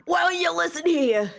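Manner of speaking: high, loud Southern scolding; Southern voice picking up the joke; Southern accent. Acted. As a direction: lay it on thick like a Southern scold. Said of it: "the southern thing for idiots".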